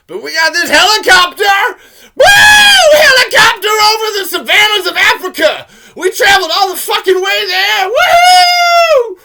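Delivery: very loud, high-pitched hollering with whoops; over-the-top yelled hype; mock-excited shouting. Mock-excited whooping about the helicopter.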